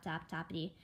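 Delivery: ordinary delivery, nothing marked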